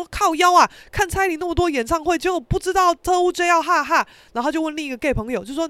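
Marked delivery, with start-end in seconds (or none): 0.1-4.0 high, complaining mock outrage; retold exasperation. Complaining about a concertgoer who forgot, exasperated. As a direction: gripe in disbelief, pitched up.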